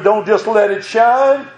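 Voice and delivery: ordinary talk, nothing marked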